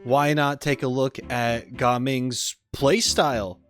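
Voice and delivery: usual speaking voice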